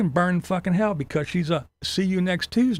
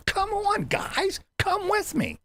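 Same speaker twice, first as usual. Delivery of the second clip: mock chirpy invite; slower than usual. Mocking the rival vlogger inviting viewers upstairs, high singsong. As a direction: coo it like a cheesy vlogger leading fans.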